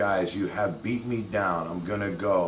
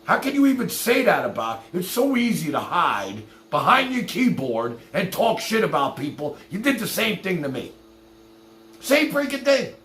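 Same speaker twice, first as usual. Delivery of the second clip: indignant outburst; 7 semitones higher than usual. Real, not acted. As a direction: call him out, voice climbing. Confronts Samir over online insults.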